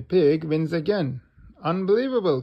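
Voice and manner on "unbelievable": loud, high exclamation of disbelief; storybook astonishment; crying out. A narrator's reading. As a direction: exclaim it, amazed. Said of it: "cries Mister Labrador".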